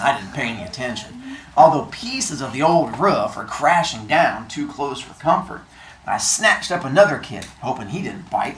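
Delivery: low, measured read-aloud; story narration. A narrator's reading. Teacher reading the novel to the class, low narration.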